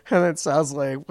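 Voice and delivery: normal talking voice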